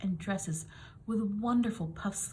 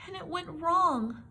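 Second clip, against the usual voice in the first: high, slow wail; 10 semitones higher, 3 dB louder, slower than usual. A narrator's reading. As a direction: drag out the misery. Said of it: "Anne wailed".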